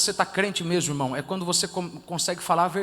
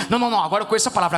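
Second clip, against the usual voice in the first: mock know-it-all; 5 dB louder, much faster than usual. Mocking a know-it-all believer.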